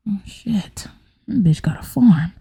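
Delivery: whispered shocked aside; hushed, comic dismay; muttered aside. Real, not acted. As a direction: say it under your breath.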